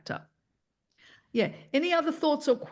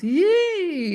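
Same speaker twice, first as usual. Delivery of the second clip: excited cheer; 9 semitones higher, 7 dB louder than usual. Real, not acted. Cheering after siren exercise.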